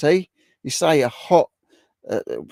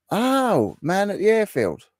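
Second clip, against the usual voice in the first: much higher, amused realisation of the role; excited exclaim; 10 semitones higher, slower than usual. Real, not acted. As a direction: say it like you just got the joke. Repeats it, high and excited.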